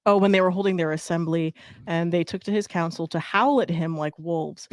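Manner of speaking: reading aloud. Acted. Friend reading the Saint Patrick legend, storybook style. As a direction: read the old legend with mock solemnity.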